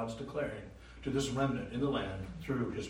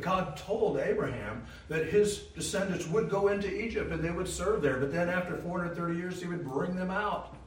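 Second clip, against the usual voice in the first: loud, high sermon build; rising preaching; 7 semitones higher, 5 dB louder than usual. Acted. Preacher telling the congregation of God's promise, loud and high. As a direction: lift it up to the rafters.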